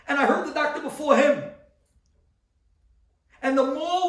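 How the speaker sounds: a rabbi's full-throated sermon build; preaching at full force. Acted. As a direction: preach it out to the crowd. Preaches wife's despair and fight.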